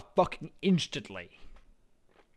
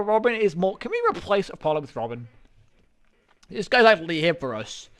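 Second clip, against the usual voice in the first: ordinary talk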